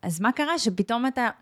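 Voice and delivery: ordinary talk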